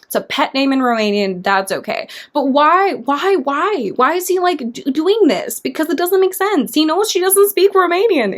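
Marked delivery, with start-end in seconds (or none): 2.3-8.4 high-pitched exasperated questioning; real exasperation, pitch raised; bothered. Real, not acted. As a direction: demand an explanation, pitch riding high. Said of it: "It just bothered me".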